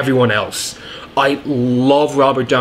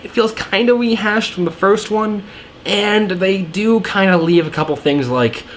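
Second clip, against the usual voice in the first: ordinary talk, nothing marked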